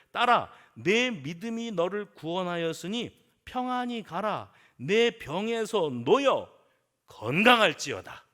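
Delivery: loud, drawn-out biblical blessing; solemn proclamation. Acted. Jesus sending the healed daughter away, solemn and commanding. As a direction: pronounce healing with full authority.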